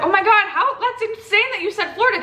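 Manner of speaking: high-pitched astonished reaction, acting it out; retold amazement. Retelling her reaction to the Florida remark, amazed.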